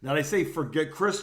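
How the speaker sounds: ordinary delivery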